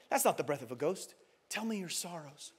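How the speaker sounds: low, softened, soothing voice; calm the listener, hushed and low; gentle reassurance. A narrator's reading. Great lion reassuring a frightened boy, gentle.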